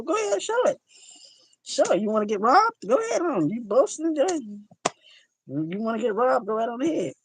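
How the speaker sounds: high, sing-song mock encouragement; mock egging-on. Host to someone flashing cash, mock egging-on. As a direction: goad the show-off in a put-on high voice. Acted taunt to a show-off.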